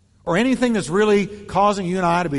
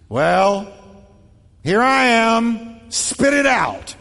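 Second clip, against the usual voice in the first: louder, higher, slow and impatient; impatient demand; 5 semitones higher, 4 dB louder, much slower than usual. Shown as a demonstration. Pastor demonstrates an impatient tone, louder and higher. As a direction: bark it like you've no time. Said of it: "The tone you use".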